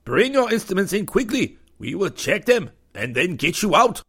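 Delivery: high, loud curt officer; a brusque commander giving orders. Acted. Connie commander character voice.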